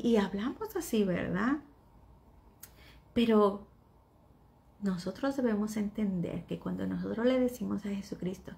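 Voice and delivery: ordinary talk, nothing marked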